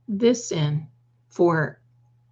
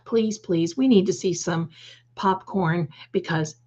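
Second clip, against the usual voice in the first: ordinary talk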